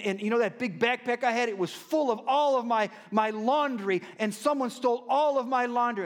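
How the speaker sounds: animated retelling of a stolen-laundry tale; imitating Vic. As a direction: play the homeless friend spinning his sob story, high and loud. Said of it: "He goes".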